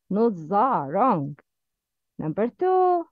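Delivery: ordinary talk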